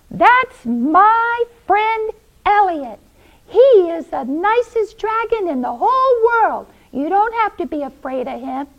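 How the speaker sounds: high, loud, emphatic announcement; high-pitched, earnest boy's voice; proud Pete. Acted. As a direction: declare it proudly to a crowd. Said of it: "And Pete told them".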